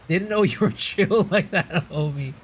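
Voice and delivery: amused, laughing through the words. Real, not acted. Streamer teases a viewer in chat, laughing. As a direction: let laughter break into the line.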